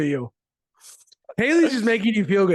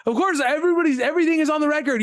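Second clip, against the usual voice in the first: higher, quicker, fed-up rant; exasperated rant; 7 semitones higher, faster than usual. Real, not acted. Host ranting about players blaming reporters, exasperated.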